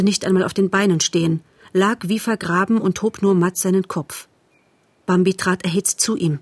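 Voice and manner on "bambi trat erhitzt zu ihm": ordinary delivery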